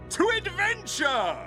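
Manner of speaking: high triumphant rallying shout; rallying cry. Acted. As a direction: cheer it out like a hero.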